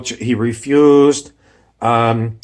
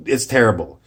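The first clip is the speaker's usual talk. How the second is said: normal talking voice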